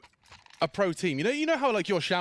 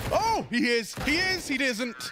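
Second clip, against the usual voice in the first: excited shout; 9 semitones higher, slower than usual. Real, not acted. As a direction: shout the play as it happens. Shouting live reaction.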